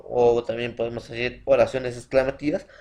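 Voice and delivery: usual speaking voice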